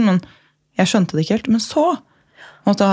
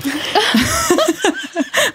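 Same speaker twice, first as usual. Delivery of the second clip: long, high-pitched laughter; high laughing fit; 13 semitones higher than usual. Real, not acted. Friend amused by a fan theory, laughing hard. Laughing at Stockholm syndrome joke.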